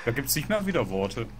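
usual speaking voice

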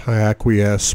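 plain reading voice

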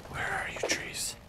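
hushed search whisper. Real, not acted. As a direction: whisper as if hunting. Searching for trees.

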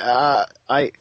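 halting, low retold admission; stammer, then confess it slowly; slow, sheepish mock reveal; hesitant admission. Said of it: "I'm like".

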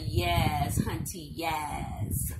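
low, drawn-out sassy praise; a touch of sassy diva approval; sassy hunty talk. Acted. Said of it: "when I be talking like that".

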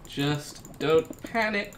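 slow, drawn-out self-calming. Real, not acted. Gamer slowly telling himself not to panic. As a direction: talk yourself down slowly. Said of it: "We're panicking".

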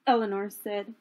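ordinary delivery

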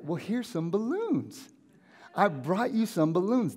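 high, lilting, lightly cheery; mock chirpy offering. Friend bringing balloons to someone hurting, mock chirpy. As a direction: present a silly gift with airy cheer.

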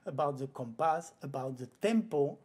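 ordinary talk, nothing marked

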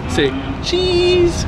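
high singsong coaxing; reading to a toddler. Acted. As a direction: coax the baby in a sweet high voice.

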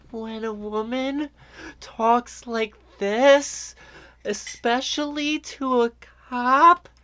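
high, slow, put-on sweet woman's voice; mock innocent feminine voice; fake girly voice. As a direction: coo it like a woman sweet-talking a cop. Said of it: "such a fake, contrived voice".

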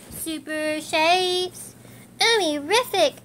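drawn-out cheer rising high. Acted. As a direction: celebrate big. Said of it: "Millie cheered".

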